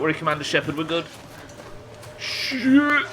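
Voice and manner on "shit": startled curse. Real, not acted. Sudden danger in game.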